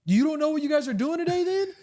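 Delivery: high, incredulous question; can't believe he doesn't know; disbelief. Retold or imitated. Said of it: "I was like".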